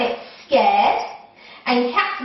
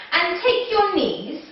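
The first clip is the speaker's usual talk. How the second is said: ordinary talk, nothing marked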